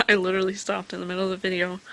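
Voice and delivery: rushed, real laughter; laughing. Real, not acted. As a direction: laugh it out at herself. Laughing at her own pause.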